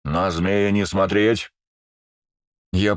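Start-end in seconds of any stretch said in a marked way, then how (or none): none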